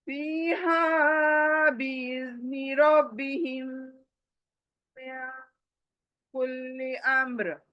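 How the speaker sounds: slow, melodic tajweed recitation; Quranic recitation. Shown as a demonstration. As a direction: chant it the way it is recited.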